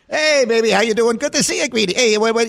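high, loud, brash imitation of Chris Russo; bad Mad Dog impression. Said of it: "I do a bad imitation".

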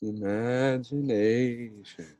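slow, soft, dreamy imagination; dreamy drawl. Acted. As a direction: stretch it out like a dream. South Park Imagination Land bit.

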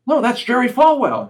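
high, loud imitation of mom insisting; imitating mom. Son imitating his mom insisting it is Falwell, high and loud.

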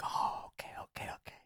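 barely voiced whisper fading out; whispered okay. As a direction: breathe the okays almost silently. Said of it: "He was like".

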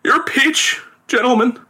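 high, grieving address; theatrical bereaved father; distraught. A narrator's reading. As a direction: present it brokenly in a high voice. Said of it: "The distraught father".

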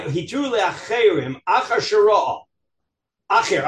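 ordinary delivery

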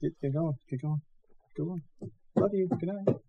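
high, soft coaxing tone; gentle bedtime voice. Real, not acted. Sending kid to bed.

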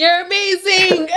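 tearful. Real, not acted. Emotional toast to her friend.

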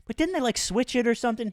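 high-pitched, casual mock query; tentative retold question. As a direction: float it up lightly, acting unsure. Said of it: "I loosely was like".

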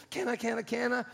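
put-on high, excited kid begging; eager child pestering the parents; pleading kid.

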